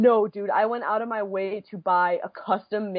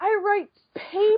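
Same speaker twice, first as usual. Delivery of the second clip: high, strained, almost wailing; exasperated rant; 10 semitones higher than usual. Real, not acted. Telling friends about her Ava credentials, high and exasperated. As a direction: vent how absurd it was.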